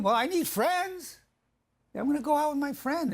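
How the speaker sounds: hammed-up spouse whining about needing friends; whiny insistence. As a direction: plead your needs like a sulky partner. Spouse justifies needs to wife.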